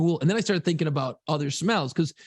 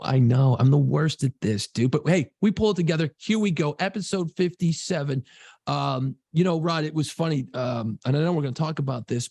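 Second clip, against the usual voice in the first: ordinary talk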